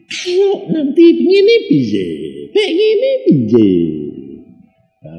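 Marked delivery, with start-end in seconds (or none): 0.1-3.7 much higher, slow anxious whine; worried fretting. Retold or imitated. Preacher mimicking a doubting believer, high slow fretting. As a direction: fret over what might happen. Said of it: "Dan kamu mikir".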